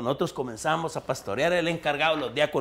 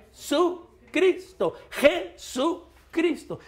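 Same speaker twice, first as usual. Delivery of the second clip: high-pitched, emphatic sermon delivery; fervent preaching; 7 semitones higher, 5 dB louder than usual. Real, not acted. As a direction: preach it out, stretching the key words. Preaches at full force. (prédica enérgica y aguda)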